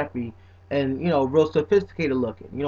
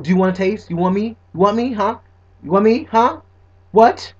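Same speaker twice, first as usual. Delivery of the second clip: higher and louder, teasingly defensive; sassy mock attitude; 4 semitones higher, 6 dB louder than usual. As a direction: play a diva telling people to mind their business. Sassy put-on persona, then "messing with y'all.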